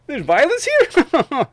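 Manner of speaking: high, loud, sarcastic fake shock; high, loud, sneering mock surprise; mock disbelief. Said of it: "And they're like".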